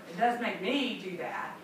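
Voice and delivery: usual speaking voice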